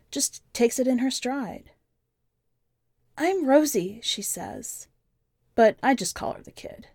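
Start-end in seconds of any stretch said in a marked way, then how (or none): 3.2-4.0 put-on higher young girl's voice, plain and matter-of-fact; the kid's voice. A narrator's reading. The kid telling the stranger about her parents, in a higher child voice. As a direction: lift the pitch to play the nine-year-old.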